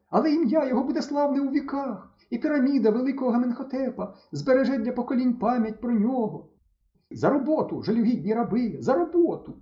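набагато вище, урочисті накази; командуй рабами пишно; владний жрець. Acted. (Стьопа владно наказує хлопцям-рабам)

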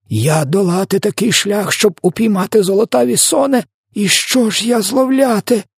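very high-pitched, hammed-up despair; wailing outcry. A narrator's reading. Giant laments his long trip's bad catch, high wailing outcry. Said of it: "волав він".

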